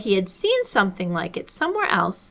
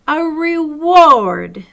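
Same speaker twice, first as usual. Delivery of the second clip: big slow booming call; very loud; 6 semitones higher, 9 dB louder, much slower than usual. A narrator's reading. Owl insisting to Pooh, very loud and slow. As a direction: boom it out slowly like a proud owl. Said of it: "said Owl very loudly".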